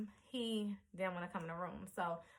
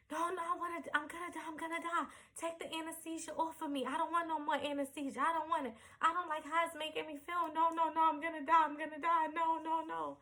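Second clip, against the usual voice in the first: high frantic "I'm gonna die" repeated; freaking out, begging them to stop; high, fast, panicky protest; panicked pleading; 8 semitones higher than usual. Retold or imitated. Bugging out, numb in room.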